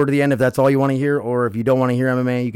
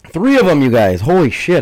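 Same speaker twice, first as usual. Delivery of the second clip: excited; 5 semitones higher, 7 dB louder than usual. Real, not acted. After sneezes.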